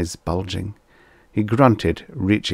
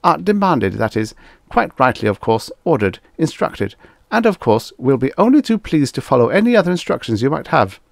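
high, hurried backpedalling; flustered yes-man falling over himself to agree; obsequious; 8 semitones higher, 5 dB louder, faster than usual. A narrator's reading. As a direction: correct yourself eagerly, grovelling. Backpedals obsequiously after being challenged.

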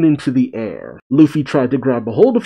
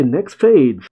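ordinary delivery

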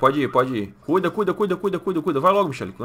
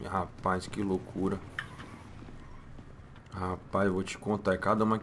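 softer, lower, talking to himself; muttered aside; 6 semitones lower, 12 dB quieter than usual. Acted. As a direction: mutter it under your breath, amazed. (resmungando baixinho para si mesmo)

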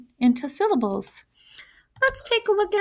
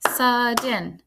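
"sudden" stretched into two beats; "sudden" slowly sounded out by syllable; split into syllables. Shown as a demonstration. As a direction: say "sudden" as sud, then den. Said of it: "I counted two claps in the word sudden".

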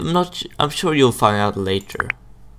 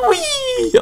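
very high drawn-out squeal; excited whee. Real, not acted. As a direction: squeal whee as the bubble lifts off. Shot up in a bubble.

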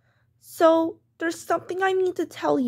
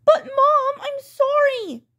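high, drawn-out whine; pleading child; 8 semitones higher, slower than usual. Acted. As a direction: beg mom to relent. Child begs off timeout.

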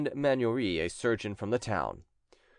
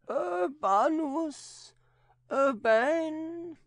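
much higher, slow, eerie possessed voice; possessed nun; much slower than usual. A narrator's reading. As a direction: speak as the demon inside her, slowly. Possessed nun names the sorcerer.

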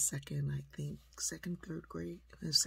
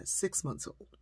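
ordinary delivery

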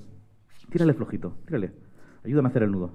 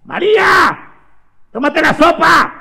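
mother calling out; 11 semitones higher than usual. Acted. Mother ordering María to eat soup, high calling. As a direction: call sharply from another room, pitched high. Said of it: "una voz que decía".

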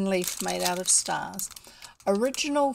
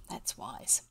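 ordinary delivery

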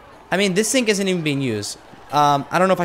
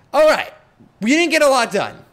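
excited sign-off; 10 semitones higher, 5 dB louder than usual. Real, not acted. Wrapping up the episode.

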